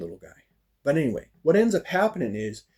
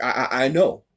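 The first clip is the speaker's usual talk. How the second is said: acting out a flustered stutter; retold stammering reply; angry stutter. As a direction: trip repeatedly on the first word. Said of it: "and so I stuttered".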